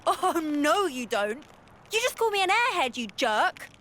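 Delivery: high-pitched, indignant comeback. Acted. Offended speaker snapping back at a smug companion, indignant. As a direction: flare up at being mocked. Bickering after being mocked as naive.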